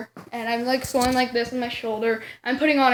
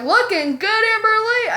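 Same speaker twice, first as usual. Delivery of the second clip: higher, singing-out friend's compliment; teasing cheer; 9 semitones higher than usual. Retold or imitated. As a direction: call it out to tease a friend.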